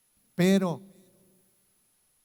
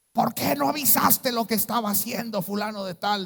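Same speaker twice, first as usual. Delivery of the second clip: higher, louder stern rebuke; stern pastor; 8 semitones higher, 5 dB louder than usual. Retold or imitated. Preacher imitates the stern pastor confronting him, rebuking. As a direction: scold him like an angry senior pastor. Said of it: "firme como él me hablaba".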